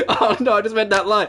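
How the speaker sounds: high words shaken by real laughter; still cracking up. Real, not acted. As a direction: try to speak through a laughing fit. Cracks up at own reading.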